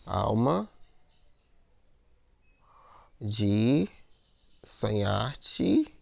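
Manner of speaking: ALMA, DE, SONHAR-TE in stretched pieces with long pauses; poetic scansion. Shown as a demonstration. Teacher scans the verse aloud, ALMA DE SONHAR-TE slowly divided. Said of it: "agora eu vou separar nas sílabas poéticas".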